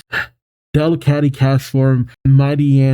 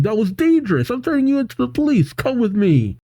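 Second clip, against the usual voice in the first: high-pitched mock indignation; pissed-off lady; 10 semitones higher, faster than usual. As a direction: act out an outraged lady, pitched well up. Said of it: "she is pissed".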